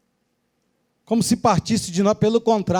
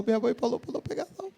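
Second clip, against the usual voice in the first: softer, quick mumbled mock-childish whine; whiny spoiled recruit; 3 semitones higher, 10 dB quieter, faster than usual. Spoiled recruit answering the sergeant, soft fast mock whine. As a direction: whine it like a pampered boy. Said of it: "O garoto falou".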